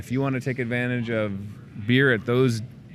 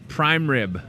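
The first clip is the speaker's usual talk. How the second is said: ordinary delivery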